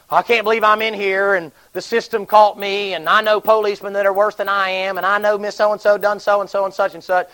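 mock an inmate's self-justifying whine; complaining. Preacher imagines an inmate blaming others, indignant complaint. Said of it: "Never did she sit there and say".

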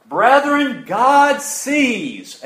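high, loud pulpit cry; preacher's proclamation. Real, not acted. Preacher telling the brethren God sees, loud proclamation. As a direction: proclaim it loudly, pitch lifted high.

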